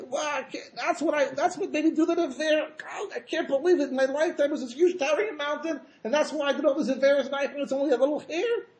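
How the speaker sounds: high-pitched wail of regret; hammed-up, incredulous lament; mock crying disbelief. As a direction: act out stunned sinners lamenting, voice held high throughout. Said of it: "And they're also crying".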